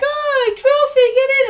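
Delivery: high, loud celebratory whoop; excited cheer. Retold or imitated. Co-host acting out guests cheering the first trophy, excited cheer. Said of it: "they were like".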